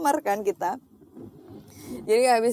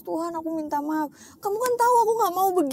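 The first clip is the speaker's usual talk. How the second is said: crying prayer; 7 semitones higher, faster than usual. Retold or imitated. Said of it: "semalam tuh aku nangis".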